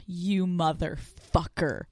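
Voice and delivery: slow, quiet imitation of an accusing text; menacing text. Man texts her late at night, low-key menace. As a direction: let each word land slowly, hushed.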